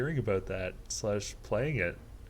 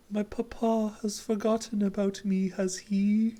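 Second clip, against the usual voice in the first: put-on high, dainty girl voice; young lady character; 10 semitones higher than usual. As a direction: play a pampered young lady, higher.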